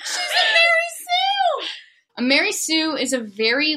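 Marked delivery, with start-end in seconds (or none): 0.0-1.7 shocked. Real, not acted. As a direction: cry it out in shock. Shocked outburst.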